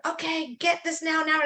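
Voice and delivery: high, pushy imitation of an order; mock demanding coach; mock bossy coach. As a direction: parody a rushed coach barking orders. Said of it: "this whole blueprint of".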